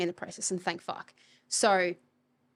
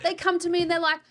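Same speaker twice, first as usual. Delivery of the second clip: mock client whine; 9 semitones higher, 5 dB louder than usual. Mocking clients' complaints.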